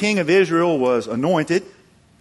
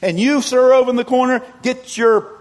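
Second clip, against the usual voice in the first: high, loud call across a crowd; single someone out from afar, loudly; raised voice hailing a straggler; 10 semitones higher, 8 dB louder than usual. Acted. Acted onlooker calls someone out.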